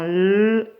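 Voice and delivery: L held long as "lll"; isolated L sound. Shown as a demonstration. Teacher giving the answer, L drawn out. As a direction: sustain just the consonant L. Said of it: "j'entends L".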